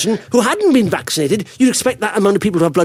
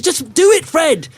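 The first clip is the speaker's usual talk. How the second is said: high-pitched coaxing, egging him on; urging on; 8 semitones higher, slower than usual. Retold or imitated. Dad to his scared son on top of the boat, urging. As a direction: push a hesitant kid to go for it.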